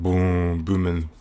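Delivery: long, level "booom" like heavy bass; drawn-out boom. Retold or imitated. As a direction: imitate a big booming sub. Mimicking an unwanted heavy bass.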